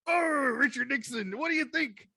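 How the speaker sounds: high, slow crab voice; Mister Krabs voice. Pretend. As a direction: drawl it like a cartoon crab. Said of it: "be like".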